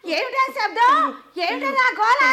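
high-pitched anxious questions; alarmed calling. Acted. Grandmother alarmed by noise next room.

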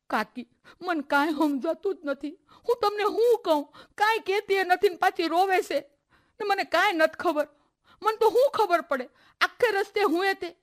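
high, helpless explaining; flustered protest. Acted. Girl telling her aunt she knows nothing, flustered.

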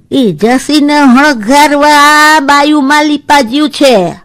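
put-on slow, high old woman's voice explaining custom; elderly neighbour explaining funeral customs to the girls. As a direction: speak like a village grandmother, slowly.

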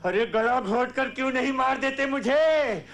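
ranting outburst, pitch climbing high; anguished accusation. Acted. Father accusing family of poisoning him, ranting angrily.

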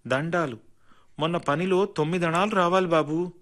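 a mild, humble request. A narrator's reading. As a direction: ask the master politely, a little meekly. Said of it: "అంది వినయంగా". (కొద్దిగా వినయంగా అడగడం)

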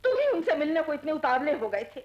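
fast, loud reproach; heated accusation. Acted. Woman blaming companion for rushing downstairs, accusing. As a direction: pile on the blame quickly. Blames him for their trouble.

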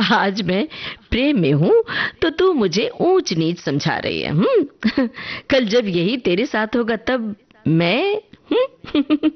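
teasing, high voice broken by giggles; laughing. A narrator's reading. Chanda teasing her friend about falling in love, laughing. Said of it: "हंसते हुए अपनी बात को आगे बढ़ाया".

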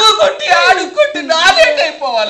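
high, tearful wailing complaint; wailing through tears; crying protest. Acted. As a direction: sob it out in protest.

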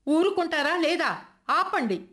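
loud high cry to stop; shout. A narrator's reading. Aruna silencing her grandmother, a loud high shout. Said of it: "ఒక్క కేక పెట్టింది అరుణ".

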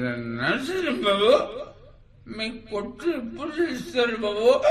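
high, drawn-out wailing plea; pestering caller. Acted. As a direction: whine it out in a high, stretched mimic voice. Mimic plays pestering caller in joke. (ఎత్తైన గొంతుతో సాగదీసిన బతిమాలే పిలుపు)

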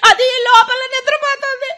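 weeping complaint; crying. Acted. Wife weeps to her husband that she can't go on. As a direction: sob out the complaint.

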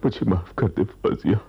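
high, desperate begging, rising at the end; anguished raving; delirious pleading. Acted. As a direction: plead feverishly with someone who isn't there. Still raving, friend tries waking him.

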